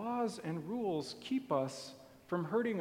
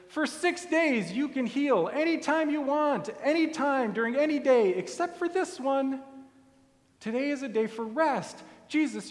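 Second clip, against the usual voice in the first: higher, preachy, indignant scolding; indignant lecture; 6 semitones higher than usual. Acted. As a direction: lecture the guest with offended authority. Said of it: "with all the indignance they can muster".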